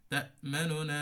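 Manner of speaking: তা'মানুনা with the নু clipped quick; quick ikhtilas. Shown as a demonstration. Teacher demonstrates ikhtilas, vowel shortened quickly. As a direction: say তা'মানুনা fast, nun only two-thirds. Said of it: "এই যে দ্রুত পড়লাম".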